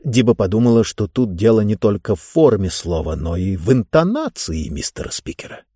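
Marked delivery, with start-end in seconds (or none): none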